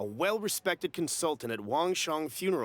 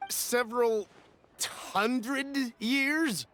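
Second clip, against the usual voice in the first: incredulous echo; 5 semitones higher, much slower than usual. Acted. Doubting the seller's claim.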